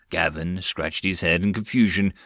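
ordinary delivery, nothing marked

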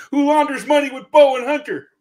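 sudden high yell out of a whisper; hammed-up presidential outburst; Brandon. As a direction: blurt it loud and high-pitched. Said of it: "that's a little Brandon for today".

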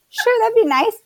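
high mock-agreeable quote through amusement; joking reply. Husband teasing wife about coming back later, joking high-pitched reply. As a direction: play along jokingly, pitched well up.

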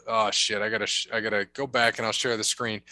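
usual speaking voice

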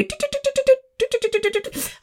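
soft, rapid rotary-dial ticking noises; imitated dial clicking. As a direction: tick softly like an old phone dial. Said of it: "stick your finger and you go".

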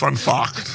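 fact said as "fahkt", breaking into a laugh; odd pronunciation. Shown as a demonstration. Reader answering "fun what", fact as "fahkt", laughing. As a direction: repeat fact with an ah vowel, amused. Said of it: "how to pronounce Focht".

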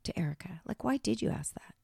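hushed, half-whispered imitation; Lisa Rinna. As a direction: quote her question very softly, almost whispering. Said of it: "Lisa fucking Rinna says".

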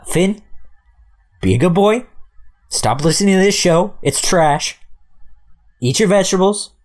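high, sing-out message to a little cousin; talking to child. Pretend. Older cousin leaving Finn advice, mock-earnest high voice. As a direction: pitch it up as for a young kid.

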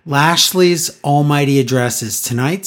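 normal talking voice

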